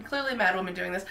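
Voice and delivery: usual speaking voice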